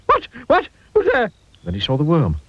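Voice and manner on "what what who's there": high, panicky startled cries; startled squeak. A narrator's reading. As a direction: jump at the sound, squeak with fright. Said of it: "nearly jumped out of his skin".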